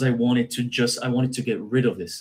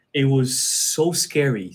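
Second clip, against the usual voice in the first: ordinary delivery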